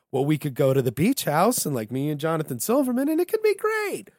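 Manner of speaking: high, loud, giddy scheming, building to a shout; hammed-up excitement climbing very high; mock excitement. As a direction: hype up a terrible plan with fake glee. Acting out a Weekend at Bernie's scheme.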